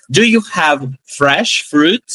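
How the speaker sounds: slow, careful phrase; slow teaching model. Shown as a demonstration. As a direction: model it slowly for the class. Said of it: "frase pra gente treinar". (devagar, didático)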